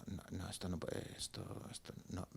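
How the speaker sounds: muttered to himself. Retold or imitated. Dismayed at the algebra board.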